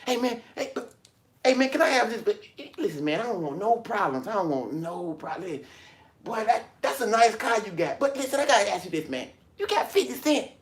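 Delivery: high, wheedling beggar voice; high pleading voice of a man begging for change; begging hustler. Retold or imitated. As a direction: sweet-talk a stranger for spare change.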